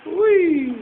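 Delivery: high squealed whee; playful whee. Real, not acted. Parent cheering the child's speedy play, high whee.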